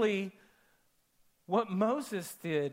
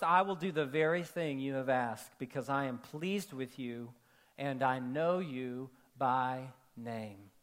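softer and much slower, lingering on each word; tender, hushed and slow; 9 semitones lower, 5 dB quieter than usual. Shown as a demonstration. Preacher repeating God's words to Moses, slow and tender. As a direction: let the promise land gently, almost whispered. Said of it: "And the Lord said to Moses".

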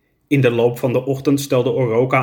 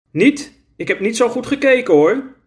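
flustered backpedal; 10 semitones higher than usual. Acted.

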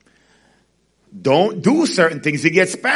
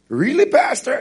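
much higher skeptical question; doubting listener; 11 semitones higher than usual. Pretend. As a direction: ask it like a doubter in the pews.

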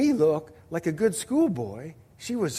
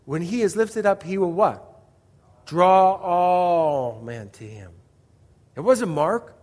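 usual speaking voice

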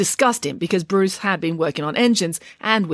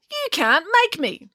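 high, slow, stubborn refusal; high-pitched defiance; defiant; 12 semitones higher than usual. A narrator's reading. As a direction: dare them, drawing it out high. Defiant reply to an order.

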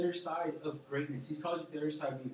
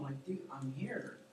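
slow, whispered, softer; reassuring whisper; 3 semitones lower, 7 dB quieter, slower than usual. Retold or imitated. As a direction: hush them gently. Jesus calming frightened disciples.

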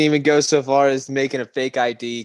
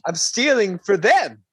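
slow, high innocent excuse; 7 semitones higher, slower than usual. Pretend. Voicing the thief's excuse.

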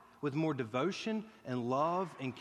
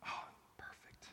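breathy under-the-breath whisper, a mild wry sigh; whispered aside; 16 dB quieter than usual. Real, not acted. Preacher to himself as his stomach growls, dry whisper. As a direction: drop to a whisper as if only to yourself.

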